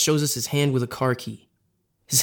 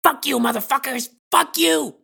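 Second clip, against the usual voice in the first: much higher, hammed-up yell; 12 semitones higher than usual. Nadesh yelling in the guys' faces, hammed-up yell. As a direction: scream it in their face. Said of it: "yells in our face".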